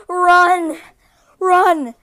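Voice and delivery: loud, high mock-panic shout; mock alarm. Dad pranking son from under the bed, mock alarmed shout. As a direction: prank your kid with a fake alarm. This fool must think he's funny.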